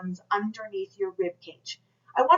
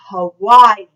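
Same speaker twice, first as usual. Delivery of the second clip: very loud, projected "Hawaii"; 15 dB louder than usual. Shown as a demonstration. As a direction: release all your air on the word. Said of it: "release all that air on the word Hawaii".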